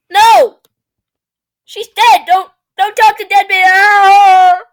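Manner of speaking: high, loud horrified yelling; hammed-up terrified outburst; mock panic. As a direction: yell a frantic warning, drawing out the end. Mock panic at talking to dead.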